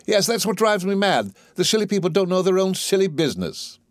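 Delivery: worked-up professional irritation; exasperated. Acted. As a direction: grumble about silly people, pitch lifted. Higgins irritated at foolish people.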